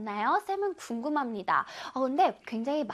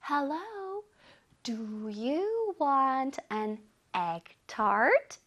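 very slow, softer, word-by-word reading; read-along model; 4 dB quieter, much slower than usual. Shown as a demonstration. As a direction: lead learners through it slowly, stretching each word. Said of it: "우리 친구도 한번 읽어보겠습니다".

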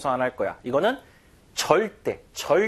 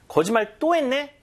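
sharp, high, louder accusing question; angry retort; 9 semitones higher, 4 dB louder than usual. Acted. To a friend who lied again, an angry accusing question. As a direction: catch the liar out, annoyed.

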